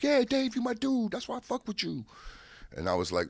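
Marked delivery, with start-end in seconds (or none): 0.0-2.1 high-pitched, imitated chummy agreement; mimicked buddy in a high voice; imitating pleased buddy. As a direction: gush like a pal, pitched way up. Pun happily agrees with his friend.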